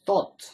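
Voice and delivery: "Thought" said high and loud with a clear th; loud, high teaching demo of "Thought"; demonstrated "Thought". Shown as a demonstration. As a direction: hit "Thought" hard, tongue out for the th. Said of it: "The word is thought".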